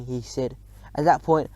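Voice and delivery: usual speaking voice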